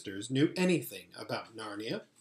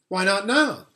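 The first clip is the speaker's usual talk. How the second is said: put-on eager boy; 6 semitones higher, 7 dB louder than usual. A narrator's reading. Edmund voiced in story.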